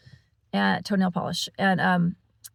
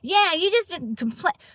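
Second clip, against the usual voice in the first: much higher, mock-indignant accusing voice; hammed-up irritated stranger cutting in; angry lady mimic; 10 semitones higher than usual. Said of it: "angry lady".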